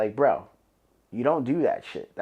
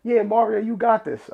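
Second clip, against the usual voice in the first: hyping himself up; 7 semitones higher than usual. Retold or imitated. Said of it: "I'm like".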